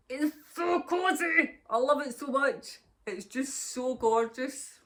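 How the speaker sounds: high, slow and gushing over the cardie; gushing delight. Real, not acted. Knitter showing viewers her finished cardie, gushing and slow. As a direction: swoon over your own knitting.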